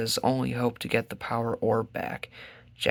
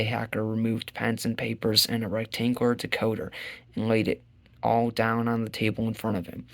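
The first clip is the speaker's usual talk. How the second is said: usual speaking voice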